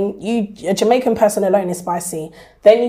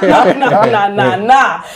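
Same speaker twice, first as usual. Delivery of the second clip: loud, giggly nah nah nah; playful laughing no; laughing refusal; 5 dB louder, slower than usual. Retold or imitated. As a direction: laugh off the idea, louder. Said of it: "So I'm like".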